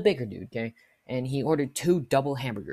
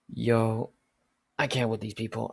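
ordinary talk

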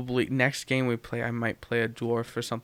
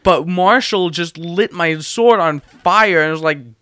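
ordinary talk

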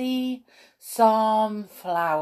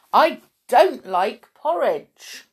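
put-on loud, higher-pitched childlike protest; emphatic story-character distaste; disgusted complaint; 7 semitones higher, 6 dB louder than usual. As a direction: refuse it with heavy stress on each word. Moo disgusted by her breakfast.

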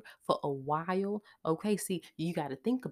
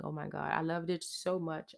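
normal talking voice

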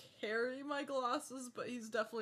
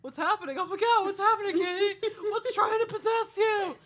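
rising, louder and higher mock panic; panicking; 11 semitones higher, 11 dB louder than usual. Co-host retells her panic at flickering camera lights, mock alarmed. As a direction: act out freaking over flashing lights. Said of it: "You did really start panicking".

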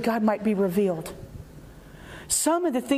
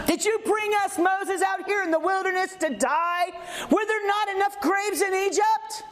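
shouting, higher, accusing Moses; angry complaint; 6 semitones higher than usual. Acted. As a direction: yell it like a mob fed up in the desert.

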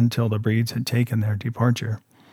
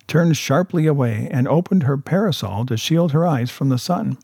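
plain reading voice

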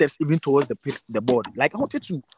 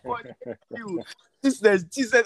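grateful prayer; 6 semitones higher than usual. Retold or imitated. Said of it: "I was like".

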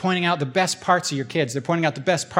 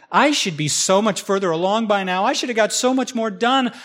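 higher-pitched pity-party complaint; self-pitying; 6 semitones higher than usual. Acted. Imagined person looking back on their life, self-pitying. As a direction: voice someone wallowing in regret. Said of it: "starting to do the pity".

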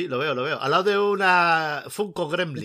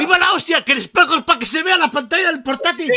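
loud, high, delighted outburst; excited shout; 10 semitones higher, 6 dB louder than usual. Real, not acted. Host shouting at a clever mirror trick, loud and delighted. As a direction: cry out in amused disbelief.

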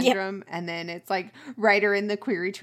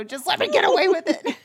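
higher, louder giggly pleading; laughing mock plea; 9 semitones higher, 4 dB louder than usual. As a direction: beg to be let off while laughing.

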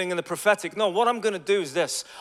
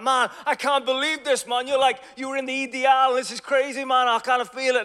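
higher, amazed disbelief; amazed excitement; 8 semitones higher than usual. Retold or imitated. Street convert stunned his evangelist was in the EDL, amazed. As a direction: gush in astonished disbelief. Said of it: "And the guy was like".

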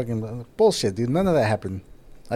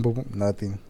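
usual speaking voice

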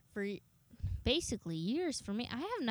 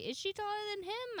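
high curious quote; 11 semitones higher, 4 dB quieter than usual. Retold or imitated. Retold question to friend.

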